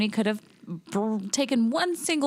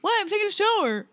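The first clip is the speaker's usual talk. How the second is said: mock Tony reply; 10 semitones higher, faster than usual. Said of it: "He's like".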